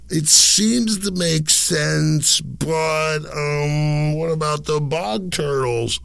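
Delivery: high, slow doubter voice; high-pitched mock head-scratcher; puzzled skeptic. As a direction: stretch it out high, like a baffled objector. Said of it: "they'd be, like, scratching their heads".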